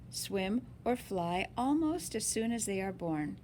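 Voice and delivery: ordinary delivery, nothing marked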